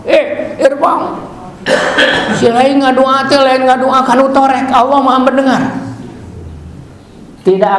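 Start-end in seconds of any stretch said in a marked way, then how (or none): 0.1-5.6 mildly reproving, pitched higher; retold reproof. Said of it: "Nabi pernah menegur kepada sahabat". (menegur dengan nada agak tinggi)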